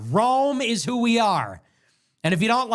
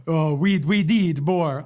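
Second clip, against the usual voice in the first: attempted African accent; 3 semitones lower, slower than usual. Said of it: "now it's Africa's turn to speak".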